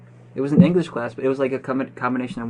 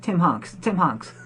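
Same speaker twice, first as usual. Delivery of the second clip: imitating Peter; 6 semitones higher, much slower than usual. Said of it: "Peter's like".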